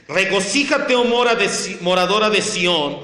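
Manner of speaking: loud, high, ringing scripture proclamation; forceful preaching. Acted. Pastor declaims Revelation to the congregation, forceful preaching. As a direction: proclaim it at full force to the hall.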